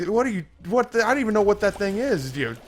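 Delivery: high-pitched, stammering bafflement; alarmed disbelief. Acted. Patient questioning doctor about the gremlin, alarmed.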